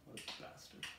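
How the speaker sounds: mock emotional. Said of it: "getting my emotions in".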